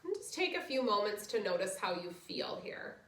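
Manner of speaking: soft, low guided relaxation voice. Acted. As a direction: ease them into rest gently. Leads final relaxation.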